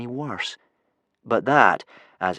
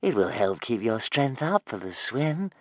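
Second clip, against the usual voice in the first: slow, soothing dragon voice; motherly; 2 semitones higher, 3 dB quieter than usual. Acted. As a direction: coax gently, drawing words out. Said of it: "in a motherly way".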